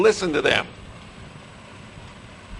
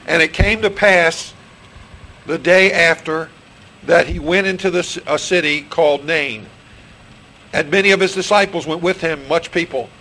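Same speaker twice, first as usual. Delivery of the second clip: scripture reading; 5 dB louder than usual. Real, not acted. Preacher reading Luke seven to his congregation, solemn and loud. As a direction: read it out weightily to the congregation.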